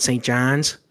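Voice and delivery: Newfoundland "John's" with an A in it; imitated Newfoundland accent, "ah" in "John's". Shown as a demonstration. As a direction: open the vowel of "John's" towards "Jahn's", Newfoundland style. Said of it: "they put that A into it".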